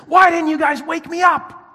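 indignant high-pitched outburst; angry shout. Retold or imitated. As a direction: yell at the crew for not waking you. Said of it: "And then I got angry".

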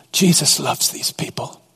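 hushed, breathed whisper; whispered awe. Real, not acted. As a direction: lean in and whisper it. Preacher's hushed climax.

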